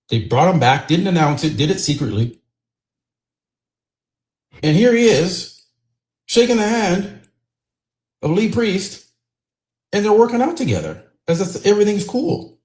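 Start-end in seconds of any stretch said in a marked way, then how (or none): 4.6-12.6 outraged disbelief. Real, not acted. Outraged reveal.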